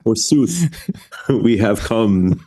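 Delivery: slow, high Shakespearean declaiming; hammy stage actor. Pretend. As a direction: ham it up like an Elizabethan player. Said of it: "please say it in rhyming pentameter".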